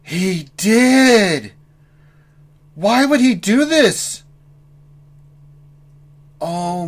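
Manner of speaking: high, loud, slow exasperation; despairing outrage at a friend; exasperated outrage. Real, not acted. Discovers friend's mistake.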